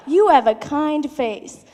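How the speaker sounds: creepy. Retold or imitated. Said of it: "I'm like, how incredibly creepy".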